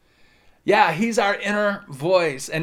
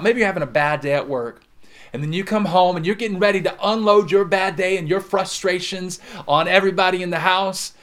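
ordinary delivery

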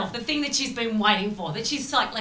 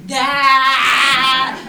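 sung ah; 10 dB louder than usual. Retold or imitated.

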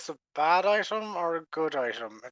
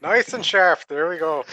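loud cheering; 11 dB louder than usual. Pretend. Sharpening dagger, cheering it on.

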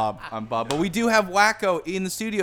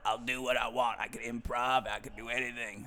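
low, softer put-on voice; interrupting guest character; 5 semitones lower, 7 dB quieter than usual. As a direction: play the stubborn intruder, low and quiet. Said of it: "sir, this is our podcast".

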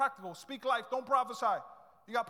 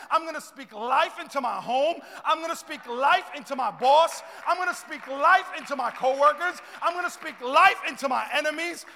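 louder and higher, climbing line by line; preaching at full force; 4 semitones higher, 13 dB louder than usual. Acted. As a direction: build each I'm gonna speak life to a peak. Building declaration to the congregation.

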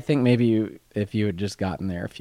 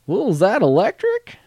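high, louder dimwit asking; goofball yokel; 13 semitones higher, 7 dB louder than usual. Retold or imitated. Voicing coverall-wearing dimwit.

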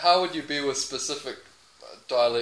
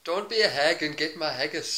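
mock Scottish with a rising end; Scottish accent. Player offering a Scottish saying, mock Scottish accent. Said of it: "What is something that Scottish people say?".

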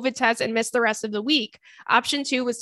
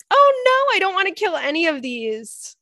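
high squeak of dismay, a bit louder; a real little yelp at the hard choice; 7 semitones higher, 3 dB louder than usual. Real, not acted. Dismayed at having to kill one.

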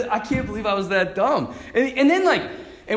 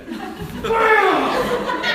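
mock bam; 13 semitones higher, 6 dB louder than usual. Friend's explosive layup retold.

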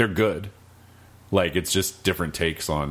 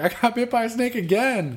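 much higher, plaintive mock whine; whiny snake-bit stranger. Stranger telling the player about another bite, high mock whine. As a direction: play the pitiful guy who got bitten again. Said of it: "he's like".

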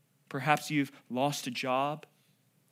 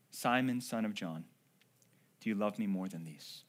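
tender question; 7 semitones lower, 7 dB quieter than usual. Acted. Jesus gently questions Peter.

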